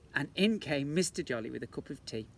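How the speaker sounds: ordinary delivery, nothing marked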